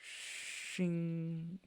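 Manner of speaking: high, soft sword-drawing sound; sword shing. Acted. GM imitating the Goliath drawing a great sword, high and soft. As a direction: make the blade slide out.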